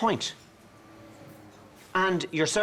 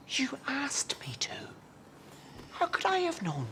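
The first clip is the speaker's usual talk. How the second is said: defensive whisper; 9 semitones higher, 10 dB quieter than usual. Acted.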